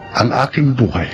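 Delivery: normal talking voice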